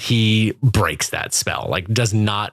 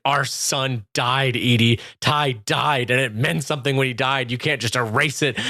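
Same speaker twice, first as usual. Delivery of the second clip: ordinary talk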